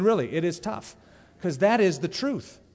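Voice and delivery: ordinary talk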